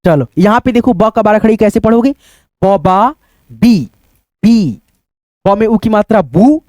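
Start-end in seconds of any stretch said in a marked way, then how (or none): none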